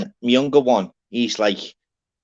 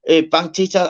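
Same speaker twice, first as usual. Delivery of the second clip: normal talking voice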